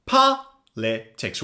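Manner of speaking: "Politics" slowly split, first syllable POL strongest; slow, broken-up "Politics" with POL stressed. Shown as a demonstration. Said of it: "stress is on the first syllable".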